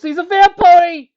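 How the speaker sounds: high, loud, drawn-out panicked shriek; terrified scream. Acted. Frightened colt screaming at Nick, terrified shriek. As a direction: scream it in terror at the monster.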